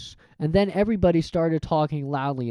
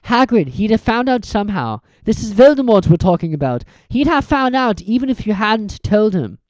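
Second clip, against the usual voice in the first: a hint of a boy's voice; reassuring, reasoning; Harry's voice; 6 semitones higher, 6 dB louder than usual. Harry comforts Hagrid.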